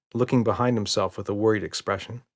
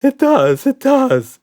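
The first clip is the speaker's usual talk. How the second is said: much higher, louder insisting; 12 dB louder, slower than usual. A narrator's reading. Red insists to Roger, high and loud. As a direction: squeal it stubbornly. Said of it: "insisted Red".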